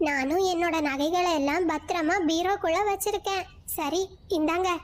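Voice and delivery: lightly voiced chattering birds; high bird voices. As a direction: chirp it out high.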